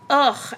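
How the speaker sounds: frustrated groan. Real, not acted. Listing her deficiencies, a frustrated groan. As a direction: let out a fed-up groan.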